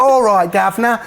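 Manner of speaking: imitating an American faking a Cockney accent, played for laughs; mock Cockney. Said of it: "they go into their Mary Poppins".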